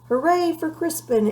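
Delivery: high, loud cheer; cheering shout. A narrator's reading. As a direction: cheer him on at full voice. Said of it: "everyone shouted".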